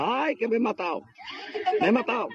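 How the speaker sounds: comic high wailing at moderate volume; mock yelling. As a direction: act out a fallen man crying for help. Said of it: "Y el gacho pegando gritos".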